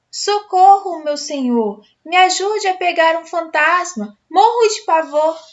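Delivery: high, loud cry for help; frightened girl calling the guide for help; panicked plea. A narrator's reading. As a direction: beg for rescue in panic.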